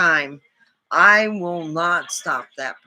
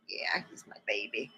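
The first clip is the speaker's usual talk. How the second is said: whisper; 8 semitones lower, 13 dB quieter than usual. Real, not acted. Cooing over her cat.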